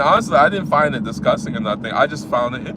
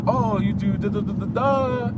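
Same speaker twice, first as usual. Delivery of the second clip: bored fake wow; 6 semitones higher than usual.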